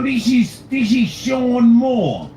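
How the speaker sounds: lightly put-on English accent; a hint of a posh English assistant. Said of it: "doing an English accent".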